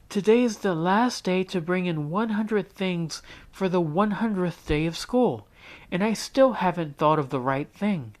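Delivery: put-on high, distressed child voice near tears; upset girl. A narrator's reading. Jessica at breakfast to Mom: high upset girl voice. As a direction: pour out the worry to Mom.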